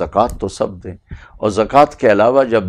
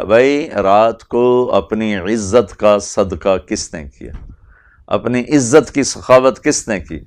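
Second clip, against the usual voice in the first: slow questioning; 2 semitones higher, 4 dB louder, slower than usual. Retold or imitated. Asking the congregation who gave at night, slow and loud. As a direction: draw the question out for all to hear. Prophet asks the gathering.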